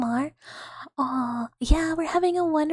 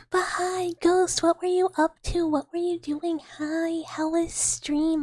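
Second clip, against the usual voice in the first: high-pitched gushing questions; excited greeting; 3 semitones higher than usual. Real, not acted. Streamer asking raider Ghost about stream, high excited greeting. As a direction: greet them high, stretching out the last words.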